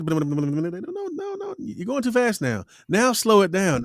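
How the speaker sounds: high-pitched mock director stopping the talent; imitated director correction. Director stops a too-fast take.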